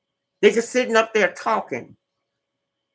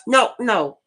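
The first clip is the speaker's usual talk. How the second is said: usual speaking voice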